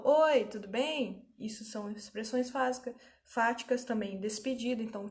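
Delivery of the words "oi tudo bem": slow, drawn-out friendly greeting. Retold or imitated. A teacher greeting someone, drawn out slowly. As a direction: stretch out Oi, tudo bem? slowly. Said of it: "eu falo".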